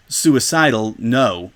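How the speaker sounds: ordinary delivery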